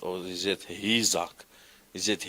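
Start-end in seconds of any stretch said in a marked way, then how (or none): 0.7-1.4 Isaac with an h sound in front; hard breathing. Shown as a demonstration. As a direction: say "Isaac" as HEE-zak. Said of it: "it's not hard, it, it's soft".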